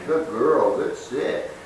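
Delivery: soft, slow, loving praise half-whispered; lavish the puppy with gentle love; affectionate praise. Shown as a demonstration. Trainer praising the puppy after a good sit, softly and lovingly. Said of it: "that's when I give all the love, all the affection".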